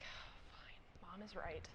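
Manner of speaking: soft unvoiced aside; whispering. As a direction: whisper it grudgingly. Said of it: "I'm gonna be like".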